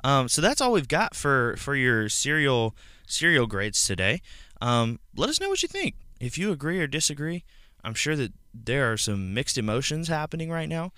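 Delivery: high, bouncy host voice; sing-song announcer voice; exaggerated cartoon intonation. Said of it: "Why are you talking like that?".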